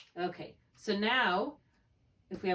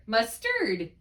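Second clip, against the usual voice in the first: "mustard" as mus-TARD, slow and loud on TARD; "mustard" with weak mus and punched TURD; stress on second syllable; 3 semitones higher, 5 dB louder than usual. Shown as a demonstration. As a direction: throw the accent onto the end of "mustard". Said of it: "say it both ways".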